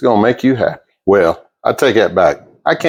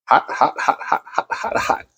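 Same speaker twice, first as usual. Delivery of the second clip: laughing whisper; 5 semitones higher, 7 dB quieter than usual. Real, not acted. Handling the hot pan.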